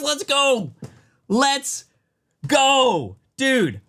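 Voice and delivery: high, stretched victory yell; real triumphant cheer. Real, not acted. Streamer celebrating the card picked for them, triumphant cheer. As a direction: draw out each word in triumph. Celebrating the Mega Charizard pull.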